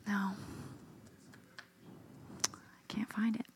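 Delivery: tired whisper under the breath; whispered, very soft, weary. Real, not acted. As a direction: whisper it to yourself, searching. Tired, searching for a word.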